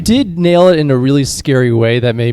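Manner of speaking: talking too loud for the mic. Real, not acted. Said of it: "You're a little loud".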